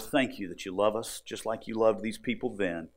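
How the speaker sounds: ordinary talk, nothing marked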